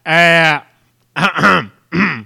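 big drawn-out, high yell; loud interrupting shout. Pretend. Co-host cuts into the list of extensions, shouting. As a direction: holler over your co-host in repeated bursts.

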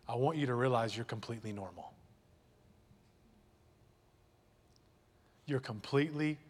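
tender pastoral reassurance; gentle reassurance. Real, not acted. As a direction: comfort those who feel empty. Reassuring the congregation.

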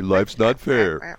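slow, drawn-out motherly put-down; mom's slow verdict. Retold or imitated. Mom answering her whining kid, slow and final. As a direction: lay it down slowly like a tired mom. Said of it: "My mom would say".

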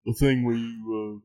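put-on light British accent, teasing the host. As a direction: rib him in a mild British voice. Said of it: "I'll become British for you right now".

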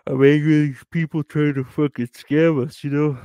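heated rant at being cheated; angry. Real, not acted. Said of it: "I'm so angry right now".

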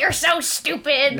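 slow mock scolding; imitating Patrick. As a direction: drawl it like a fed-up friend. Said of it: "Patrick, like".

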